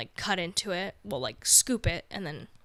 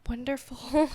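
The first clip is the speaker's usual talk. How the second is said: choked up and wistful, a little higher; emotional, with long halting pauses; getting emotional; 5 semitones higher than usual. Real, not acted. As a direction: wonder it aloud, halting with emotion. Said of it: "I get even emotional thinking about it".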